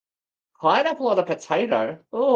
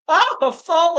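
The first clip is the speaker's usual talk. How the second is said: 1 excited high exclamation; 11 semitones higher, 6 dB louder than usual. Real, not acted. Reacting to season suggestion.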